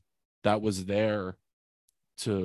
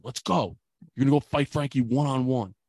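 raised, higher-pitched fatherly command; retold yell; 4 semitones higher, 4 dB louder than usual. Father ordering his frozen son to fight, mock yell. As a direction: imitate an angry dad yelling an order. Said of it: "he, like, really yells it".

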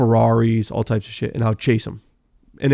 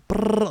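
engine imitation; 9 semitones higher, 3 dB quieter than usual. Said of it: "bouncing off limiter goes by me".

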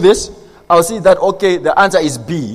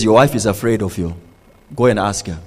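very low, softer voice for God; 9 semitones lower, 4 dB quieter than usual. Retold or imitated. God sending a husband to his wife, low and quiet. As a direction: drop right down and instruct quietly. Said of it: "He says".

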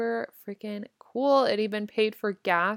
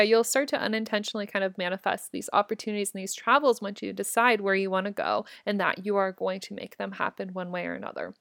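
usual speaking voice

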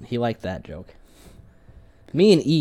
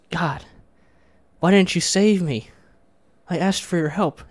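high, slow, aggrieved appeal; acted wounded protest to God; plaintive complaint; 6 semitones higher, 5 dB louder, slower than usual. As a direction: plead loudly with drawn-out words. Joke: dead rabbi complains to God.

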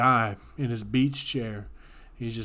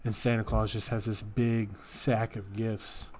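ordinary talk, nothing marked

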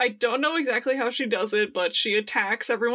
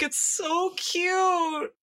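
slow, high squeal of delight; gushing; 7 semitones higher, much slower than usual. Real, not acted. Fan gushing over concept sketches, long high squeal. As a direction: draw out the cuteness.

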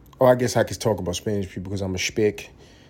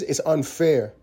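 normal talking voice

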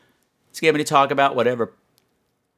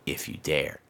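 low, much softer sinister dare; spooky dare; 12 semitones lower, 11 dB quieter than usual. Pretend. Host daring listeners in a low creepy hush.

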